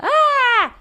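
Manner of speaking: put-on sudden high shout of a toddler; short piercing mock yell. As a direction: belt out a random kid's yell. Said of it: "just like a".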